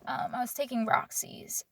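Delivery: hushed confession, barely breathing the words; whisper. Real, not acted. Admitting her pill habit to the viewer in a whisper.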